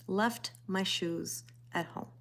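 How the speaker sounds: hushed, slow echo of her own mistake. Real, not acted. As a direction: let it sink in, quietly and slowly. Repeating the mishap for emphasis.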